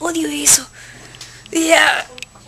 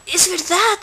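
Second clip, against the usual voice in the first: usual speaking voice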